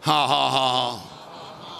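deliberate, evenly paced ha-ha; imitated laugh of faith; forced laugh. Preacher acting out how he laughed and laughed, forced. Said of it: "I just laughed and laughed".

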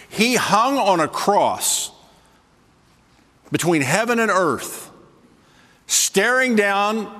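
high, slow, drawn out; slow dramatic telling. Acted. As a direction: stretch each word for weight. Preacher building a crucifixion image.

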